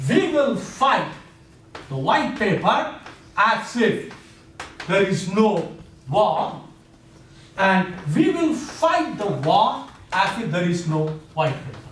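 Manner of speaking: very slow, weighty declaration; solemn quotation. Retold or imitated. Lecturer quoting the Zionist leaders' statement, slow and solemn. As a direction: lay out each phrase like a proclamation.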